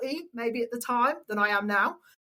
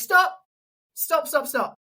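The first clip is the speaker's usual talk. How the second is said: sudden louder, higher first word, then easing; imitated interruption; 4 semitones higher, 3 dB louder, slower than usual. Announcer halting her after one line, abrupt. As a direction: cut in as if halting an audition.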